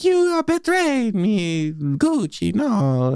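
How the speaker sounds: high, slow, melodramatic Italian lament; mock-tragic Italian voice, sinking at the end; Jared Leto. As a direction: wail the accusation in a fake Italian accent. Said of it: "he's just like".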